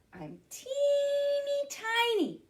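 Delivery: high, slow squeaky little mouse; tiny mouse voice. Pretend. Teacher voicing a tiny mouse, high and small. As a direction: make yourself sound teeny. Mouse speaks in the guessing game.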